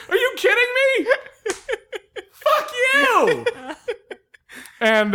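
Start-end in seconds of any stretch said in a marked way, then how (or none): 0.1-3.5 laughing disbelief. Real, not acted.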